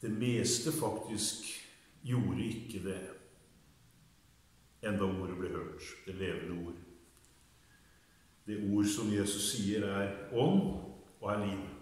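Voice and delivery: low, soft and subdued; quiet, low-pitched devotional delivery; hushed devotional talk. Real, not acted. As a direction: speak softly and reverently, low in the voice.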